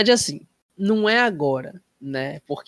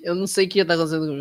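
ordinary talk, nothing marked

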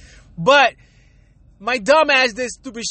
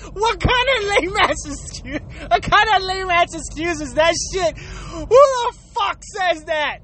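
high-pitched, crying with laughter; laughing disbelief; 6 semitones higher than usual. Real, not acted.

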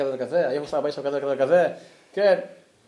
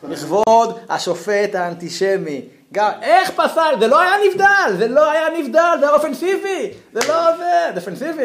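high, loud, slow outraged yelling; hammed-up shouting at the ref, high and loud; mock furious shout; 9 semitones higher, 7 dB louder than usual. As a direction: rage at the referee like a wronged fan. Shouting at antisemitic referee.

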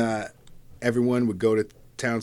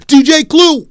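hype shout; 17 dB louder, slower than usual. Retold or imitated. Calling out DJ name.

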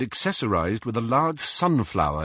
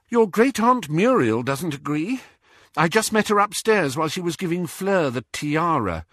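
higher female character voice; young woman, piqued; 9 semitones higher than usual. A narrator's reading. Young witch reporting great-aunt's disapproval, higher-pitched and piqued. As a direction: report the slight in a raised pitch. Hermione recounts meeting the great-aunt.

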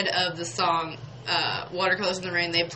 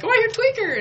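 much higher and louder, thrilled fan; excited request; 6 dB louder than usual. Retold or imitated. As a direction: shout your favorite request happily. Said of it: "I was like".

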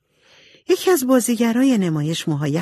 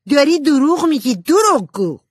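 loud, high angry shout; 10 semitones higher, 6 dB louder than usual. A narrator's reading. Jo yells at Amy for lying, a loud shout. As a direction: yell the accusation. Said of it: "جو داد زد".

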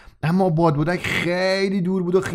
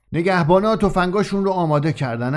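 plain reading voice

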